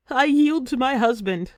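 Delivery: slapping the floor, she yells her surrender; screaming surrender. A narrator's reading. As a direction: yell it out as she gives in. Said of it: "she screams".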